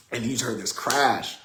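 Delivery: ordinary talk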